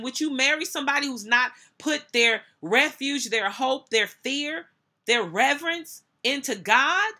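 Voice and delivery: high, loud, driving preacher's questioning; preaching at full force. Acted. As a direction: hammer the congregation with rhetorical questions.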